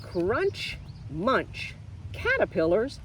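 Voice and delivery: lively sing-song read-aloud, high and bouncy; picture book reading. Acted. Teacher reads picture book to children, lively sing-song. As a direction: read it to little ones with bounce.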